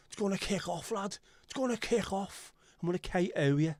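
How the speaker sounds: high-pitched Wirral hard-man threat; mock-aggressive Wirral accent. Someone being hyper-aggressive towards you, mock-threatening Wirral accent. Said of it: "someone's being hyper-aggressive towards you".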